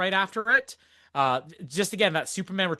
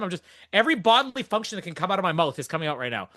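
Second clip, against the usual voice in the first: ordinary delivery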